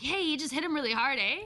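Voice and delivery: excited Canadian aside ending on eh. Retold or imitated. Remarking on a hard hit at the wrestling, Canadian eh. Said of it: "I say eh a lot".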